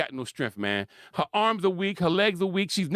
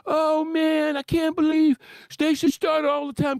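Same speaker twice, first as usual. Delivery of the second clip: higher, louder fan outrage; mocking Facebook fans; 8 semitones higher, 4 dB louder than usual. Commentator mocking Facebook fans, higher and louder. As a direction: ham up shocked fans. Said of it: "everyone in Facebook was".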